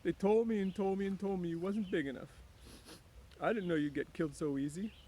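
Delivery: high Lenny, mournful; Lenny lamenting. A narrator's reading. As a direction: repeat the warnings in grief.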